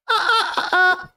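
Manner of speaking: high, whiny fake sobs; mock sobbing wails. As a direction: sob it out in a hammed-up wail. End of mock lament.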